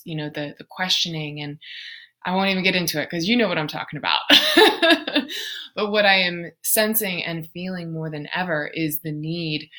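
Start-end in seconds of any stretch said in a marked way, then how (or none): 4.3-5.7 loud, high laugh. Real, not acted. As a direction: burst out in a high, knowing laugh. Laughs after declining to elaborate.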